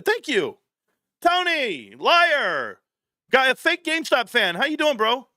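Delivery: high, sneering thanks and greeting; sarcastic mock thanks. Real, not acted. Calling out Tony to the chat, high sarcastic scorn. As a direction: needle the fake fan with scorn. Mocking Tony as fake fan.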